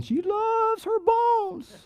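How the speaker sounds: slow high doting voice. Real, not acted. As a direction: coo it like talking about a pet. Doting over the family dog.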